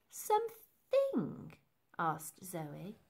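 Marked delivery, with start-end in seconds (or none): 0.1-1.5 very high, slow, drawn-out query; high-pitched, incredulous echo; puzzled question. Acted. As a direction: stretch the word out in bewilderment. Zoe asks in puzzlement.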